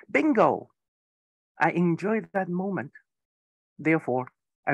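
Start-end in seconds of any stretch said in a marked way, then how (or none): 0.1-0.7 higher-pitched, lightly called-out bingo win; mild mock shout. Speaker showing a bingo winner's cry, mildly shouted. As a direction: call out the win, only half shouting. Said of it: "you scream and shout".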